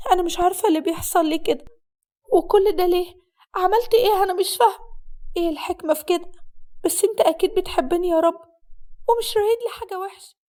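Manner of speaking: high, pleading voice of an unhappy bride; distressed bride. Acted. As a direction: plead upward to God in distress. Voices the distressed bride praying alone. (عروسة حزينة بتناجي ربنا)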